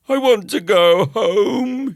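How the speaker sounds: high, loud, slow wail; tearful boy begging to leave; crying. Acted. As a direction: plead through tears. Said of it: "he cried".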